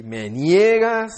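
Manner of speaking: huge accusing voice, acted at full force; booming inner voice. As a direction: let it thunder inside the head, stretching every vowel. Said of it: "escucho dentro de la cabeza así".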